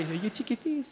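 put-on high baby-talk question. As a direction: coo it up high. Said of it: "they can just go".

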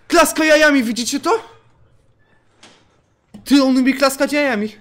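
gleeful astonished exclamation; amazed shout. Real, not acted. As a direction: shout it in wonder to the chat. Amazed at the game creature.

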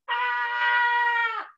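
mock scream for a drawn figure; pretend yell. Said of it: "somebody here that's yelling".

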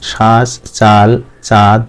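শ্বাস, চাল, চাঁদ slowly, each aa held; long vowels. Shown as a demonstration. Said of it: "দীর্ঘ হবে, কারণ". (শ্বাস, চাল, চাঁদ-এর আ দীর্ঘ করে)

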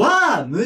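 "ワーム" with an open, long ah vowel; tempting misreading. Shown as a demonstration. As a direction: read "ワーム" with a long ワー sound. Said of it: "読みたくなるんですけど". (「ワーム」とワーを伸ばす誤読)

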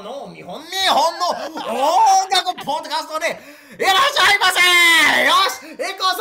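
loud, hyped Japanese TV host. Shown as a demonstration. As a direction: belt it like a variety show host. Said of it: "I'll do it again with correct grammar".

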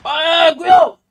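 very high, loud shriek; screamed warning. Real, not acted. Sudden warning in game.

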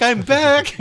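high, loud mock announcement; mock triumphant return. Person returning to Facebook within a week, mock triumphant. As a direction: burst out with a hammed-up comeback.

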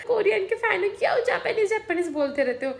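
high mock father, baffled and scolding; mocking dad. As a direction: ham up dad's confusion about Korean shows. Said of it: "पापा बोल रहे हैं".

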